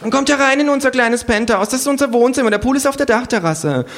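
high, loud gushing host; trendy hostess welcoming guests, high and loud. Acted. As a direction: show off the penthouse.

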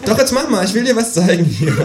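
high kangaroo voice, pleased with itself; the kangaroo gloats about its scheme; cheeky kangaroo. A narrator's reading. Kangaroo urges.

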